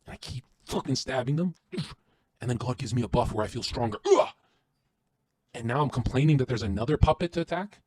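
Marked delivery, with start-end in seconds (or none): 4.1-4.3 short, forceful loud grunt; power-up grunt. Acted. Streamer getting a buff from God, power-up grunt. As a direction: grunt as if surging with new strength. Acts out feeling stronger from buff.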